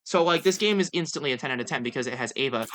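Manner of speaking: ordinary talk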